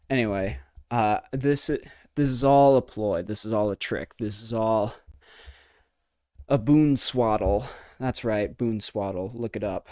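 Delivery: ordinary talk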